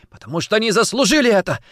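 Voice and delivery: fast, high, loud outburst; rapid, heated shout; blurted out. Acted. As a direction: burst out before thinking. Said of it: "выпалила Варя".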